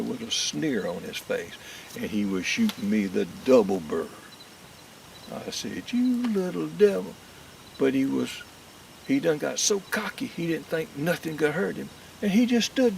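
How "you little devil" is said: mock scolding. Cursing the cocky squirrel.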